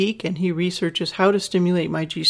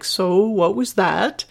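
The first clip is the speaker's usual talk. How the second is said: bemused question; slower than usual. Retold or imitated. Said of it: "sort of bemused".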